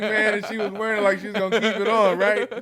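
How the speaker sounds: loud, high, laughing words. Real, not acted. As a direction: still laughing at the memory. Laughing retelling dog's antics.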